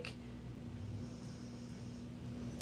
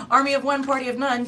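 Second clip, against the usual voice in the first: higher, louder playful proclamation; mock dramatic exit line; 6 semitones higher, 4 dB louder, faster than usual. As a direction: announce it like a grand walkout. Playful voiced client leaving.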